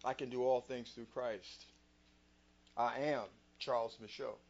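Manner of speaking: soft, low words to the mirror; hushed private affirmation; quiet self-talk. Shown as a demonstration. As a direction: tell yourself quietly in the mirror. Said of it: "I looked in the mirror".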